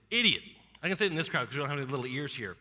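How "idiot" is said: higher, louder punchline; punchline reveal. Shown as a demonstration. Preacher reveals the spelled word to the crowd, punched. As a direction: land the answer to the riddle. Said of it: "see if you can spell that one".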